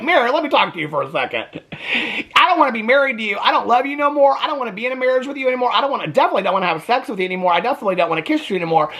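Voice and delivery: lightly mocked husband, rattled off fast. Acting out husband's breakup speech.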